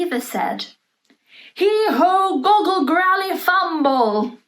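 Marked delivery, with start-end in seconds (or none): 1.5-4.4 loud, high incantation of nonsense words; magic words. A narrator's reading. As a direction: chant the spell like the Queen casting it. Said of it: "said the magic words backwards".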